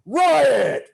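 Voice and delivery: huge, high, drawn-out yell; big brawler's shout. Acted. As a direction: holler to set off a bar riot. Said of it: "like, a very big guy".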